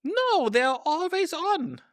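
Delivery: high, loud, drawn-out protest; exasperated. Real, not acted. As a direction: protest it slowly and loudly, voice way up. Exasperated answer about unwanted subtitles.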